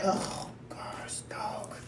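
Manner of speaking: annoyed whispered aside; exasperated whisper. Retold or imitated. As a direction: mutter under breath when the phone rings. Annoyed phone pickup acted.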